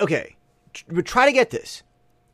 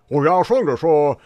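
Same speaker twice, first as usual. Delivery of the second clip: thick, ridiculous Beijing accent, louder; pretentious Beijing accent; 5 dB louder than usual. Shown as a demonstration. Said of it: "pretentious Beijing accent".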